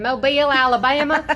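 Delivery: loud Southern hometown callout; proud loud answer. Retold or imitated. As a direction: announce the hometown. Audition answer retold.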